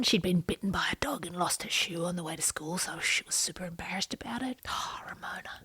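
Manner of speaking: hushed sympathetic whisper. Real, not acted. Retelling Ramona's embarrassing day, hushed whisper. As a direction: whisper it like a sad secret.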